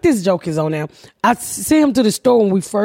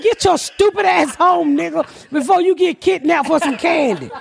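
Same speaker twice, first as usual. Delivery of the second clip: exasperated scolding; 7 semitones higher than usual. Retold or imitated. Mother scolds gullible son on phone.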